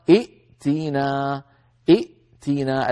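ائتنا slowly with two hamzas, the second one silent; original form shown. Shown as a demonstration. Teacher showing students the root form ائتنا. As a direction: say ائتنا slow, stopping on the hamza. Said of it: "لأن أصلها".

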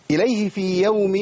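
إليه في يوم with في يوم merged through a nasal ghunna, slowly; nasal ghunna demo. Shown as a demonstration. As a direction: stretch the nasal sound into في يوم. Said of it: "خد بالك". (في يوم بغنة من الأنف، ببطء)

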